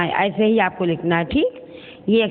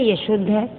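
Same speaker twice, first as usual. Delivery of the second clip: ordinary talk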